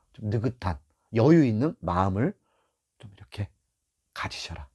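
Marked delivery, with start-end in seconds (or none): 3.0-4.7 breathy whispered "가지셔라"; whispered closing advice. Real, not acted. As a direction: drop to a whisper. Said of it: "trailing advice".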